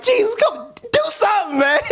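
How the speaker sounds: put-on pleading girl.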